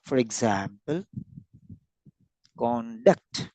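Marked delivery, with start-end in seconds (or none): 2.6-3.5 "conduct" split in two, second syllable stressed; con, short pause, then DUCT on "conduct"; word stress demo. Shown as a demonstration. Teacher giving an example of word stress, conduct in syllables. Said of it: "there is word stress also".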